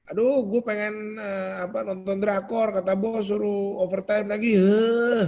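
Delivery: high mock whine ending in a loud huff; acted-out online grumbling; whiny complaining. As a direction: moan like a put-upon worker. Acting out whining complaint about boss.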